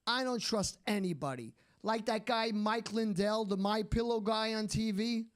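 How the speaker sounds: normal talking voice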